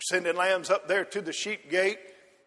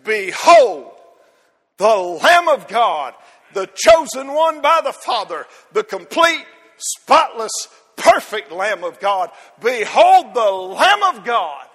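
proclaiming; 3 semitones higher, 10 dB louder than usual. Retold or imitated. John the Baptist calling to the crowd at the river, loud proclamation. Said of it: "And then he says".

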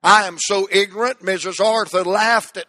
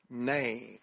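"Name" said low and soft as the congregation answers; low echoed name; 8 semitones lower, 14 dB quieter than usual. Shown as a demonstration. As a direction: drop "Name" quietly. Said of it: "Say that with me".

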